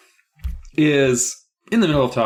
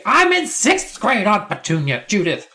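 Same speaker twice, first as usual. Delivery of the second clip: indignant nephew; 10 semitones higher, 3 dB louder than usual. Acted. Nephew correcting Aunt Judith about his grade, indignant. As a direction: correct your aunt with mock outrage.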